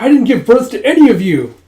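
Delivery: high, loud exasperated snap; indignant retort. Acted. Yun retorts after the group calls him Mom. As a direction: reject the mom title. Reply to being called Mom.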